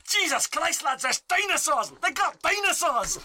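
put-on Scottish accent. Player's character warns the lads of dinosaurs, Scottish accent. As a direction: exclaim it in a hammed-up Scottish accent. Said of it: "trying to do a serious Scottish accent".

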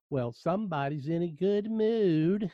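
slow, lightly mocking sarcasm; drawn-out dry jab at a cheerful traveler; sarcastic. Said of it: "in a very sarcastic voice".

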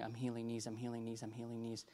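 very soft, repeated like a murmur; inner voice. Retold or imitated. God's words repeating in the preacher's head, hushed. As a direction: imitate a persistent hushed prompting.

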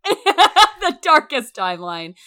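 high, slow and laughing; drawn-out laughing echo. Real, not acted. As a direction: stretch it out while cracking up. Laughing repeat of quote.

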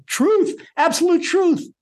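high, rising preacher's fervour; impassioned sermon voice; fervent preaching. Acted. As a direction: preach it with mounting passion. Impassioned teaching.

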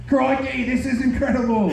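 high excited Australian exclamation; Steve Irwin. Retold or imitated. Comic imitating Steve Irwin thrilled by wildlife.

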